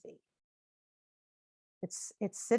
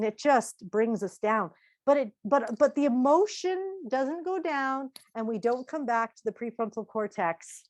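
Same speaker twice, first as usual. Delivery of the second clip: usual speaking voice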